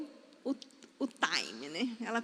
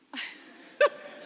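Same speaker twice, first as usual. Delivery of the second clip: voiceless mimicked sob burst; imitated child's crying. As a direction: imitate a little kid bursting into tears. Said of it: "ela fez assim".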